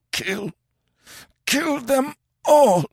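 high croak building to a loud slow cry; rising cry. A narrator's reading. Dying Raksha screaming vengeance, croak building to a cry. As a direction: let the croak break into a shout on all.

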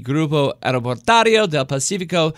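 the company name said again with a light mock Spanish accent; a hint of playful Spanish pronunciation; fake Spanish accent. Host sums up the stock's score, lightly mock Spanish accent. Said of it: "phenomenal job with the pronunciation".

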